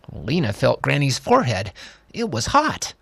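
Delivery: plain reading voice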